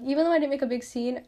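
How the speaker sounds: ordinary talk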